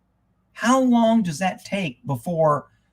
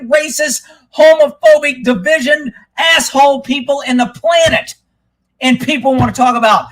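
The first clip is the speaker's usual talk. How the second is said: real angry rant, raised pitch; fired up; 6 semitones higher, 4 dB louder than usual. Real, not acted. Ranting about the left to the audience, fired up. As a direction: rant at full steam, punching out the insults. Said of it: "Shit, I got all fired up".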